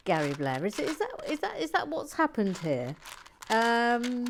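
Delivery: loud, high mock indignation; mock outrage. Real, not acted. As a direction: play up the outrage. Mock outrage at polite messages.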